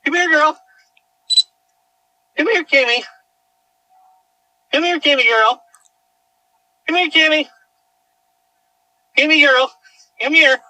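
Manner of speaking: high, loud sing-song coaxing; coaxing call to dog. Real, not acted. Owner coaxing her dog to come back, high loud calls.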